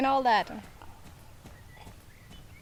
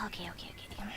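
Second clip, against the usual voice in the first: whispered to herself; a soft whispered okay, okay; 3 semitones lower, 12 dB quieter, much faster than usual. Real, not acted. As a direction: breathe it, no voice at all. Sorting out spilled water.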